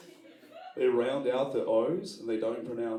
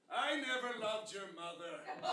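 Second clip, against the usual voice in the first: put-on drunk dad; 10 semitones higher, 9 dB quieter, slower than usual. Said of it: "he's like".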